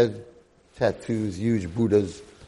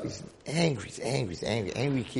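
usual speaking voice

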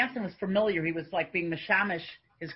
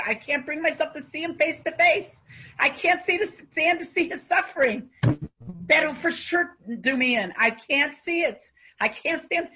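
higher and louder, pained and pleading; anguished plea; 9 semitones higher, 6 dB louder than usual. Retold or imitated. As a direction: cry out that you can't bear his suffering. Rav's anguished refusal, retold.

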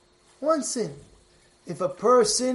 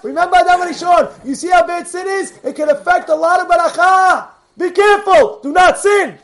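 loud, high, driving sermon voice; fiery preaching; 8 semitones higher, 9 dB louder than usual. Acted. Rabbi firing up his listeners with a loud sermon. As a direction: preach it out to the congregation at full force.

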